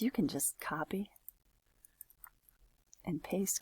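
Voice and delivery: soft unvoiced whisper. Real, not acted. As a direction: whisper the tip aside. Whispered aside about accents.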